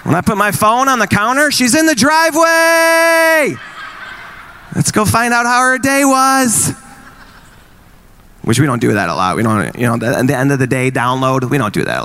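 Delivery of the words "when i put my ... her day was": mock excitement. Kids excited mom is home.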